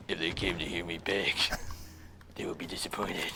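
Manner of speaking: low, hushed impression voice; Arbiter. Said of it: "excellent impression of the Arbiter".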